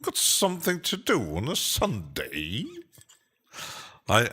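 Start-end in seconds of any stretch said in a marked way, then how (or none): 0.0-2.6 put-on playful British accent, high swooping pitch; British accent with big rises and dips; Judge Jervis. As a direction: put on a hammed-up British lilt. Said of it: "I'm sounding like Judge Jervis now".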